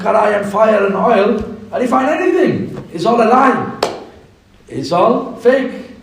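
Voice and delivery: mock the old fish's promises, rising; scornful youth. Small fish tells the big fish it's all fake, scornful.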